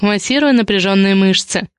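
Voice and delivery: ordinary delivery, nothing marked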